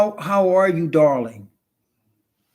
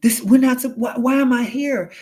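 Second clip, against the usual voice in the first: the wife waking up to the fake world, alarmed; shocked realization; 6 semitones higher than usual. Retelling the wife discovering it is all fake, a shocked higher outcry. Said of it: "So she was like".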